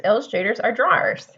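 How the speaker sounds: plain reading voice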